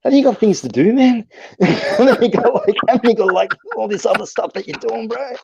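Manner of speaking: mock exasperated. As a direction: pitch it way up like you're fed up with him. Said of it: "I'm like".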